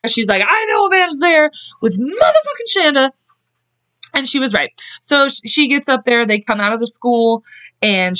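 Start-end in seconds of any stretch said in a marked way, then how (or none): 0.5-3.1 high-pitched, indignant imitation; mock jealous outburst. Host retelling Melinda's drunken demand, mock jealous outburst. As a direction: act out a jealous rant, pitch leaping up.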